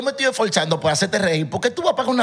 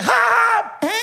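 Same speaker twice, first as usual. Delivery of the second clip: mock laugh; much slower than usual. Shown as a demonstration. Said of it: "realmente tiene que ser".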